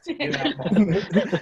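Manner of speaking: slow loud threat. Retold or imitated. New kid warning Rodney, slow laughing threat. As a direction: stretch it out as a final warning. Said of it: "Now I'm gonna give you".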